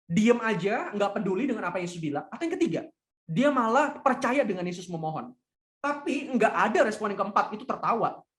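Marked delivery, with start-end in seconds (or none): none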